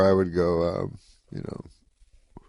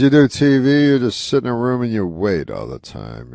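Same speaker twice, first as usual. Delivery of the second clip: loud, raised mock complaint; Rodney Dangerfield; 5 semitones higher, 7 dB louder than usual. Comic griping to a kid about TV, lightly imitated, loud. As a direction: launch in loud and high, then settle. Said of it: "He goes".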